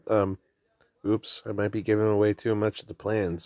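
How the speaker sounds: normal talking voice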